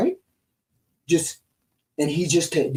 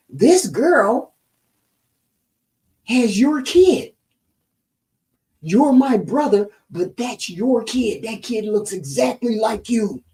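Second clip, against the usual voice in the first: urgent telling; 6 semitones higher, slower than usual. Retold or imitated. Brother tells the missing father about his child, insistent. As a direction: press the news on your brother. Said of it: "and says".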